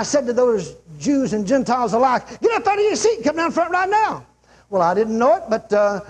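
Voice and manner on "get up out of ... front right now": high, urgent call to the crowd; re-enacted high-pitched altar call; preacher's altar call. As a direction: summon them forward from the pulpit.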